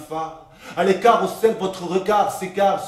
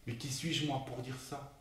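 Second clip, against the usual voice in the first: very soft, lower-pitched musing; hushed confession; 4 semitones lower, 9 dB quieter than usual. Acted. Assistant confessing a secret daydream, hushed. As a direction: confide it quietly, almost to yourself.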